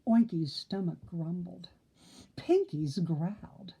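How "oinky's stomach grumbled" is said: ordinary delivery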